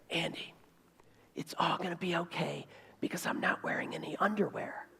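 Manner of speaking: very soft, intimate whisper; whispered conviction. Acted. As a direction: whisper a steely secret reassurance to the groom. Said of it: "a little bit of kind of conviction".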